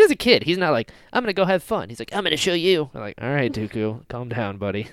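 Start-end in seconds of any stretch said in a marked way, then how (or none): none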